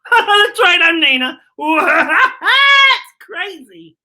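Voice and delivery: loud, bubbly welcome full of laughs; excited greeting. Acted. Host greeting the kids, loud and giddy. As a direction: burst in thrilled to see everyone.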